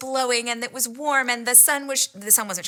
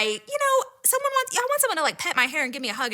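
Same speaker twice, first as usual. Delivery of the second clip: high, squeaky little voice; coy high-pitched admission; bashful confession; 7 semitones higher than usual. Real, not acted.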